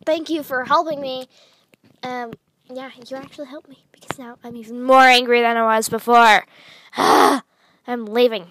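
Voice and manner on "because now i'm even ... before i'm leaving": angry. Acted. Angry sister storms off.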